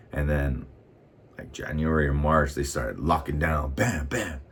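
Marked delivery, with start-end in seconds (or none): none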